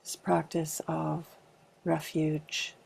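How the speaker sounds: calm, unhurried meditation guidance with long pauses; spacious meditation leader's delivery; guided meditation. Acted. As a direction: gently guide a group into arriving, leaving space between phrases. Leads a body meditation.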